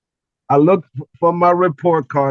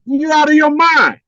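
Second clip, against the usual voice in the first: much higher, louder, slow outraged retort; going off; 11 semitones higher, 8 dB louder than usual. Retold or imitated. Student telling the dean he's crazy, loud outraged retort. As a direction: fire it back at him, disbelieving and loud. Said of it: "I was going off".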